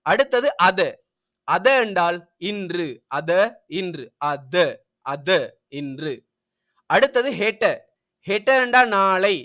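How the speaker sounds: ordinary talk, nothing marked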